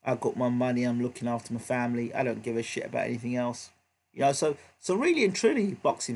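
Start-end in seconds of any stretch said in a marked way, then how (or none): 0.0-3.7 low, soft, couldn't-care-less mumble; put-on indifference, pitched down and quiet; imitated private indifference. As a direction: shrug it off privately as the fighter. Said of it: "Behind closed doors, he might be".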